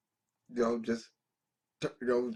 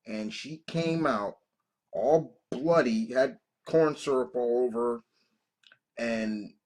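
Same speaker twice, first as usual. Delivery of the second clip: usual speaking voice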